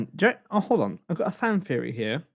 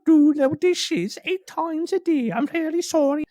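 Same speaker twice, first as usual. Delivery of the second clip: squeaky brother voice; 6 dB louder than usual. Retold or imitated. Boring brother begging off for the dishes, very squeaky. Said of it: "It's very squeaky".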